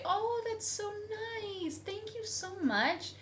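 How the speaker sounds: high sugary thanks; fake polite American. Wife mocking Americans thanking politely. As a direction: gush with fake sweetness.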